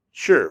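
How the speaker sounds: "Sure" dropping sharply in pitch; fourth tone. Shown as a demonstration. Teacher demonstrating the fourth tone, "Sure" with a sharp fall. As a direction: give "Sure" a firm falling tone. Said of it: "fourth tone".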